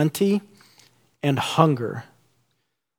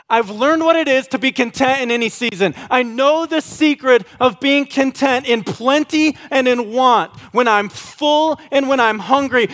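much higher, lifted voice for Paul's words; voicing Paul; 11 semitones higher, 4 dB louder than usual. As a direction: read Paul's line in a raised, bright character voice. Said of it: "And so Paul goes".

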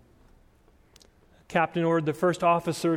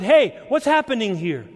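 alarmed captain's raised-voice shout, narrated; scene: preacher retells captain's last recorded words, shouting louder and higher; 5 semitones higher, 6 dB louder than usual. A narrator's reading. Call out like the startled captain. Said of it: "The captain shouted".

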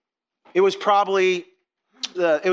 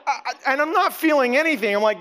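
normal talking voice

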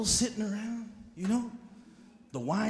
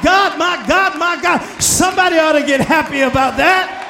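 full-force preaching; 6 semitones higher, 16 dB louder than usual. Acted. Preacher shouting praise to the congregation. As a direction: preach it out to the whole church.

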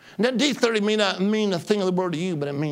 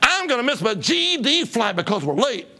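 retold angry outburst; 7 semitones higher, 4 dB louder than usual. Man beside him on the plane, a retold angry gripe. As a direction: imitate a furious passenger. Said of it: "And he said".

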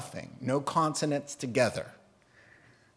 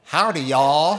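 loud Texan twang; broad mock Texas drawl; Texas accent; 8 dB louder than usual. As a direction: greet like a hammed-up Southern boy, Texas accent. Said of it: "Are you from Texas? You Southern boy?".

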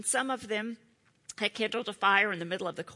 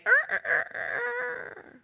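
rooster crow; 4 dB louder, slower than usual. Retold or imitated.

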